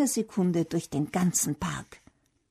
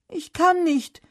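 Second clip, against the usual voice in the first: high, louder panicky cry; calling out; 9 semitones higher, 6 dB louder than usual. A narrator's reading. Felix stuck on the branch answers Bernd, calling out. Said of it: "ruft Felix".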